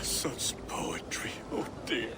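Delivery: whispered, close to tears; hushed and overcome; moved whisper. Acted. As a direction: groom moved to tears by his bride's vows. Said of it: "You make me cry".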